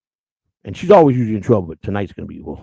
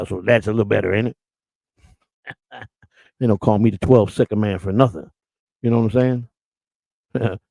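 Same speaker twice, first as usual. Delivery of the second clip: usual speaking voice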